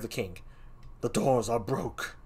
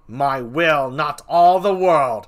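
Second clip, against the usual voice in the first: defiant Laertes; 6 semitones higher, 9 dB louder than usual. Acted. Laertes defying the king, loud and defiant. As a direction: plant your feet and refuse.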